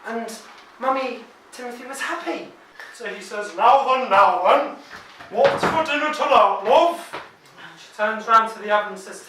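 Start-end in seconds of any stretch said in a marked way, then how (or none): 3.5-7.0 loud, rising cheery dad asking his wife; hearty dad. Acted. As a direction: boom it out like a jolly father home for tea. Dad cheerfully asks about dinner.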